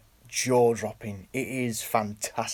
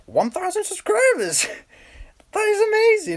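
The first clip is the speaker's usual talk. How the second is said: much higher and louder, giddy; squealing excitement; 7 dB louder than usual. Real, not acted. Vlogger thrilled about his thousand subscribers. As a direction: squeal it with joy.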